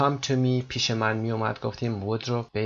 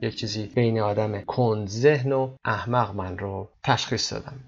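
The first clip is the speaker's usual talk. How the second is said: ordinary talk, nothing marked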